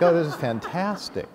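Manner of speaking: high, laughing rush of wonder; giddy delight. Retold or imitated. Marvelling at the glowing wood grain, laughing and giddy. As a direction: gush over it through a laugh.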